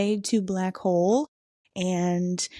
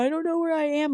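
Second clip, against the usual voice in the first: mock tearful freak-out; 8 semitones higher, faster than usual. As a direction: imitate a panicked, crying voicemail. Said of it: "like, freaking out, crying".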